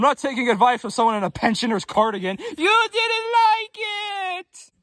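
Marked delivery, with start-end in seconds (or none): 2.4-4.7 high, slow, drawn-out wail; a dramatic high-pitched lament; wailing catchphrase. Acted. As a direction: stretch it out in a high, crying whine.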